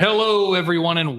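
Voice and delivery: big bright showy hello; excited greeting. Real, not acted. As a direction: open the show with a big hello. Podcast host opening greeting.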